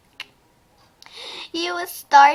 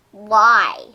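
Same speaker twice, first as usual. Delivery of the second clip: ordinary delivery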